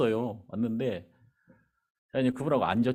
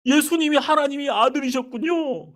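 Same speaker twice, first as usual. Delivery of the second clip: imitated sobbing; 14 semitones higher, 6 dB louder than usual. The bus driver weeps to the pastor, high wailing sob. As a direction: mimic the driver weeping his realization. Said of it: "막 우는 거야".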